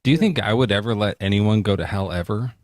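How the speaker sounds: usual speaking voice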